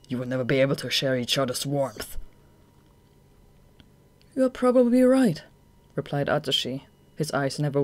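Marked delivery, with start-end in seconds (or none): 0.1-2.2 cold villain voice. Acted. Akutagawa's cold verdict. 4.3-5.4 higher, firm and calm; steady young hero. Acted. Atsushi answers calmly.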